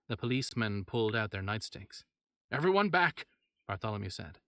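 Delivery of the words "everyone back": loud, higher urgent command; doctor ordering the room back from the stirring corpse, loud and urgent; urgent warning. Acted. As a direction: bark it to push everyone away. Doctor warning as body moves.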